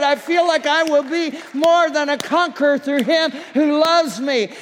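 rising to a shout, high and loud; fired-up churchgoer. Pretend. Imagined congregant leaving church, building to a triumphant shout. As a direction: build from glad to triumphant yelling.

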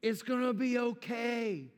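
heavenly Father promising never to leave, slow and reassuring; God comforting. Acted. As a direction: speak as the Father holding his child. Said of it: "your heavenly Father to come around you today and say".

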